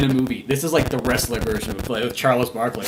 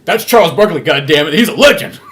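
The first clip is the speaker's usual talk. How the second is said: indignant outburst; 8 dB louder than usual. Retold or imitated. Defending Barkley indignantly.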